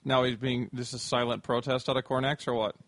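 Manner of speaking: ordinary talk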